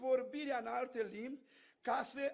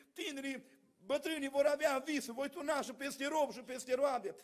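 normal talking voice

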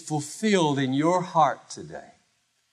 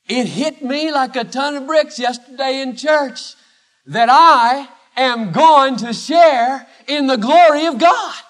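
louder, higher, bursting with joy; overjoyed declaration; loud joyful exclaim; 7 semitones higher, 8 dB louder than usual. Pretend. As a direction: shout out the good news to a coworker. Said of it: "You may not say it that loud".

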